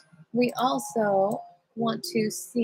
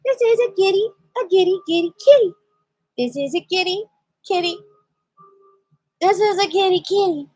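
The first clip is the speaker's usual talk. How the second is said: high, playful cooing over a cat; high sing-song kitty talk; 7 semitones higher, 6 dB louder than usual. Real, not acted. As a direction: show off the kitty in a high silly voice. Playful baby talk about the cat.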